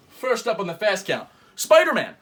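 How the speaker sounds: punchy host intro; announcer. Acted.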